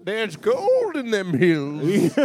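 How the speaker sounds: mock gold-rush holler; old prospector. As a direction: stretch it out like a hammed-up old-timer.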